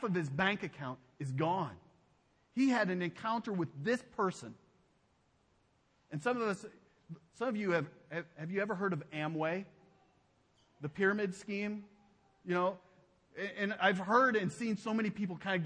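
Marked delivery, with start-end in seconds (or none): none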